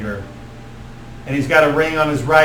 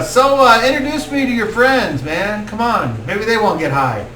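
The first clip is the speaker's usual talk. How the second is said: higher stoner voice fading to normal; stoner druid; 7 semitones higher than usual. Acted. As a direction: ask to meet the friends. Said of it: "Woodward's like".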